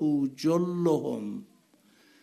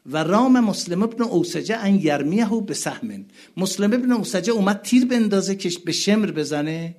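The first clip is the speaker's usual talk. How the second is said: high, loud mourning recitation; impassioned preaching; 8 semitones higher, 7 dB louder than usual. Acted. Preacher crying out to the mourning gathering, impassioned. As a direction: cry it out to the mourners.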